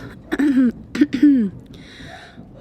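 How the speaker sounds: a firm, loud throat clear. Real, not acted. Throat clear before telling her girlfriend about her ex. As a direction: clear the throat, bracing to confess.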